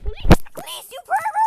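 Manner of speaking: high loud fake offended cry; mock outrage. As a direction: squeal it like you're scandalized. Mock indignant outburst.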